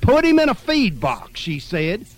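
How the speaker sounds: put-on outraged on the baby's behalf; excited outrage. A narrator's reading. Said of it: "Imogene got all excited".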